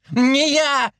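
high-pitched squeal; squealing denial. A narrator's reading. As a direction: squeal it in panic. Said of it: "визжал сторож". (испуганный визг)

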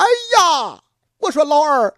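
alarmed cry. Acted. Elder brother cries out in alarm.

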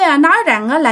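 ordinary delivery, nothing marked

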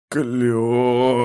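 one long, strained croak; terrible dying wheeze. A narrator's reading. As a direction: wheeze the word out in agony. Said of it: "страшно хрипел граф".

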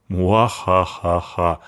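slow theatrical villain laugh; sinister fake laugh. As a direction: ham up an evil laugh. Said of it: "зловеще изобразила смех".

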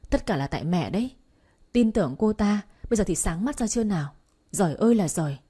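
plain reading voice

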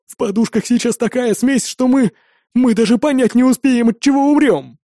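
high, anxious whine; whining. A narrator's reading. Engineer warning the captor of deadly gas, whining. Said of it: "ныл инженер".

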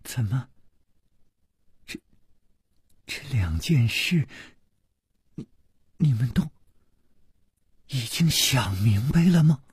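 hesitant, halting question with long pauses; shaken, broken-up question; faltering unease. Acted. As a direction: stumble over the words as if found out. Cornered woman falters at being exposed.

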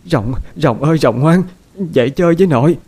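high, loud sobbing plea, rising; sobbing grandmother. A narrator's reading. Grandmother sobbing over her dead grandson, high wailing plea. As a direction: wail the name, begging him to wake. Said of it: "khóc nức nở".

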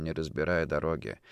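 usual speaking voice

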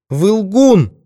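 high, loud cry of accusation; woman's piercing-high accusing cry; sudden outcry. A narrator's reading. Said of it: "вдруг вскрикнула она".

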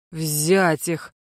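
low rasping Взять их; wheezed order. Acted. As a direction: croak the order into emptiness. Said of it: "просипел я".

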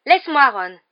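high, loud, furious command; angry snap. Acted. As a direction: shake him off with an angry shout. Hermione angrily shakes Ron off.

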